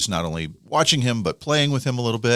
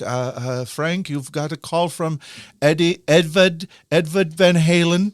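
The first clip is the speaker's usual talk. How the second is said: put-on Gail's odd accent, much higher, louder on Edward; weird accent; 8 semitones higher, 3 dB louder than usual. Gail, Frank's wife, calling Frank to the phone in a weird accent. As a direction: imitate Gail's strange accent announcing the caller. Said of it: "who has a kind of weird accent".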